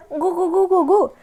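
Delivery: high, playful imitation of a gurgling baby; baby cooing.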